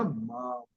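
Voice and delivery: "मा" held with a humming m; anusvara as M. Shown as a demonstration. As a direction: draw out the m sound in "मा". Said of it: "वहां पे उसका उच्चारण होगा".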